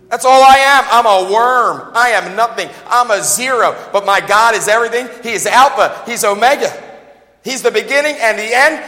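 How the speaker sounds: loud, rising preacher's cry; full-force preaching. Real, not acted. Preacher crying out his worth to the congregation, loud. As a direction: proclaim it to the whole room.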